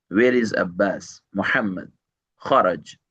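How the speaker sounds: ordinary delivery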